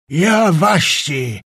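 slow shout, "Ja" hit hard; angry shout. A narrator's reading. Czapliński shouting at Skrzetuski, first slow angry shout. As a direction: bark "Ja waści!" in rage, stopping short. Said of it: "puszczał tylko urywane wyrazy".

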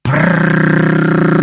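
long high lip trill; engine warming up. A narrator's reading. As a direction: buzz your lips like a child revving a car. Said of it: "rozgrzewał się Krzysiek". (długie dziecięce warczenie wargami)

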